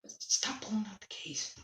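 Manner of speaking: whispered aside. Real, not acted. Whispering at little sister to stop pulling the case. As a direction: hiss it off to the side so the mic barely gets it. Hushed aside to someone off camera.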